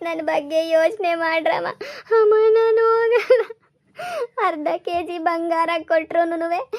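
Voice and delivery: tearful, high, slow pleading through sobs; crying plea. Acted. Daughter begs her mother not to send her away, crying. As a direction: beg your mother while breaking into tears.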